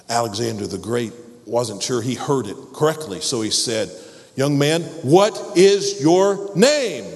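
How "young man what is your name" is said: louder, higher and slow; sterner repeat. Acted. King demanding the soldier repeat his name. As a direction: press him hard for an answer.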